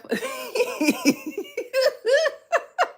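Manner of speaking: high rising laugh. Real, not acted. Laughing at own remark.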